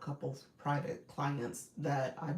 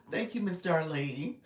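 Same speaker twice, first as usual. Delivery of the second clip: ordinary delivery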